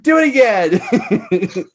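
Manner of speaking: high, slow, laughing demand; gleeful, drawn-out urging; delighted egging-on. Retold or imitated. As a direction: cheer them on through laughter. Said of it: "To me, I'm like".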